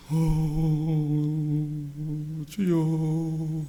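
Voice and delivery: stretched-out, breaking cry of "주여"; drawn-out mournful "어, 주여"; weeping plea. Acted. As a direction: groan it out like a man sobbing before God. Voicing a weeping penitent's prayer.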